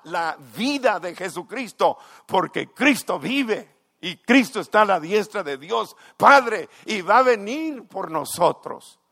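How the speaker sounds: loud, climbing pulpit proclamation; full-force preaching. Acted. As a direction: proclaim it to the whole church. (predicación a todo pulmón)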